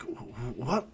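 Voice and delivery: soft, halting disbelief; stunned stammer. Acted. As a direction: quietly stumble into a rising question. Kakashi stunned by the revelation.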